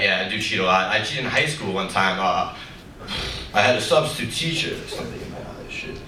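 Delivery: usual speaking voice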